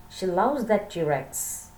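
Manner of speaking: ordinary delivery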